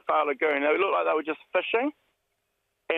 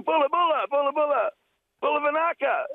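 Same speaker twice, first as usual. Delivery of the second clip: shouting greetings; 11 semitones higher than usual. Retold or imitated. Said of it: "I started shouting".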